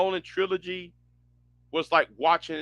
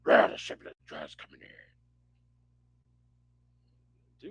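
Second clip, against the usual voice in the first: fast, much lower mumbled growl; mumbled Batman growl; 6 dB quieter, faster than usual. Retold or imitated. Streamer mocks unintelligible Bale Batman, low fast growl. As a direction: garble it like a hoarse caped hero. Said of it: "you can't tell what Bale's Batman was saying".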